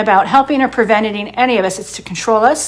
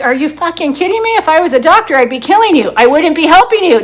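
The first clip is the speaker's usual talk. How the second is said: high, louder indignant outburst; indignant retort; 6 semitones higher, 4 dB louder than usual. Retold or imitated. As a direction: fire back at the doubters. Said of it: "I'm like".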